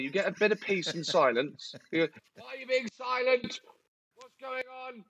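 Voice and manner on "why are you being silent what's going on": higher, softer wary questioning; suspicious dog owner. Retold or imitated. Owner suspicious of the silent dog, high soft wary questions. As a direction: ask the quiet dog what it's done.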